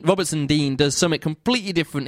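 ordinary talk, nothing marked

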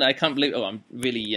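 ordinary delivery

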